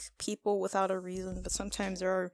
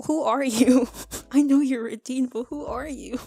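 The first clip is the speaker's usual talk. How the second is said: indignant outburst; 5 semitones higher, 8 dB louder than usual. Retold or imitated. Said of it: "I'm like".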